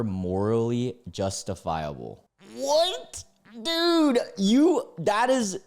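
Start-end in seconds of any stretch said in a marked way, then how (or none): 2.6-5.6 high, loud stunned yelp of disbelief; shocked outburst. Real, not acted. Streamer reacting to the cousin's twist, shocked yelp. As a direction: blurt it out, floored by the twist.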